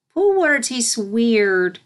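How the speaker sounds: high, slow grossed-out filly; filly disgusted by pool water; grimacing filly. A narrator's reading. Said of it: "she grimaced".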